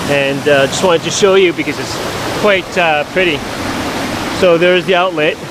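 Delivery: calling over rushing water. Real, not acted. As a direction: speak up over the falls.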